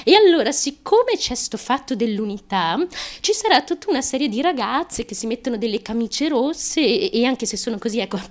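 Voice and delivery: ordinary talk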